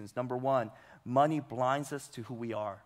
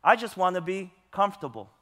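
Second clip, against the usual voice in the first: mocking quote; 6 semitones higher, 10 dB louder than usual. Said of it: "I hear over and over again this common phrase".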